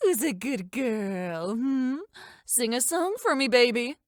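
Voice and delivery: slow, drawn-out coaxing of a pet; cooing pet talk. Acted. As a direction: coo to your baby dragon. Coaxes her pet dragon.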